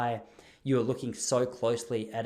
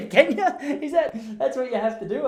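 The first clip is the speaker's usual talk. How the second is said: laughing hard, much higher and louder; 11 semitones higher, 8 dB louder than usual. Real, not acted. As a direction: burst out laughing at the absurdity. Laughs at own awkward dilemma.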